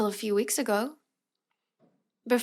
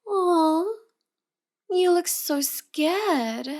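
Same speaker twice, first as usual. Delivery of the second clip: high, slow fake sympathy; mock cooing; 7 semitones higher, much slower than usual. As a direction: coo at him like a pet. Mock cooing at scared captive.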